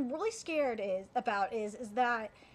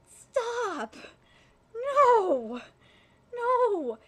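real pained shout of protest, louder and higher; anguished protest; 8 semitones higher, 7 dB louder, much slower than usual. Real, not acted. Fan yelling at anime scene, anguished shout. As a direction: yell at the screen in disbelief.

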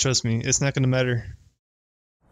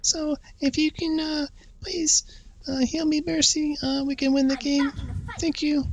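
put-on girly voice, pitched up; girl voice; 2 semitones higher than usual. Streamer asking the healer for help, in a girl voice. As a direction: plead politely as a pretend girl. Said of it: "Do the girl voice?".

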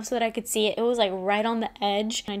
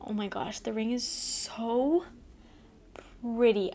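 softer, slow, breathless admiration; awed hush; 5 dB quieter, slower than usual. Real, not acted. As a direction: hush in awe of the ring. Admiring the ring on screen.

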